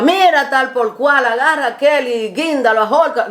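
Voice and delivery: a hint of a harsh curse; mock harsh yell. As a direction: bark it out rudely. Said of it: "no vi que le decía".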